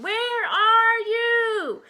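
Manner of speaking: long, high, loud searching call; slow high-pitched holler; shouting over storm. A narrator's reading. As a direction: draw each word out over the wind. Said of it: "he shouted above the wind and rain".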